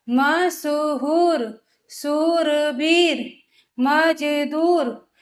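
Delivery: very slow, each word drawn out for pupils; slow word reading. Shown as a demonstration. Teacher reading words to young pupils, very slow and clear. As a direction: read every word slowly and clearly, like a teacher. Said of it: "जैसे मैं आपको पहला बता देती हूँ".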